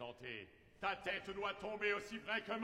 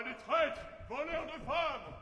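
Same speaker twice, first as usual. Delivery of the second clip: outraged; 6 semitones higher than usual. Acted. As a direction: cry out in outrage. Outraged discovery.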